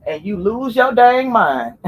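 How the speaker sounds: laughing out the words; cracking up mid-sentence. Real, not acted. Laughing through her own point.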